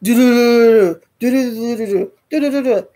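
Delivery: rattling rapid-fire drill noise in bursts; imitated drill sound. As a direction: imitate a drill with a fast stutter. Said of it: "I heard this sound".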